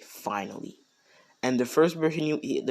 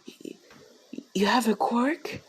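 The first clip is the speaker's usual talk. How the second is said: high, stammering mother voice; joyful disbelief; 12 semitones higher than usual. Acted. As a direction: stammer into an amazed, high-pitched question. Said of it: "she has tears of joy".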